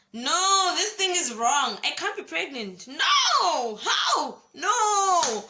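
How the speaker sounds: high, loud half-laughing cry of denial; panicked disbelief. Retold or imitated. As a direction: shout it in shocked, laughing panic. Disbelief at positive pregnancy test.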